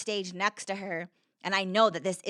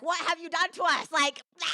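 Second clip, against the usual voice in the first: womb yelling; 10 semitones higher than usual. Retold or imitated. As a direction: voice the womb yelling, high and strained. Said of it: "my womb was yelling at me".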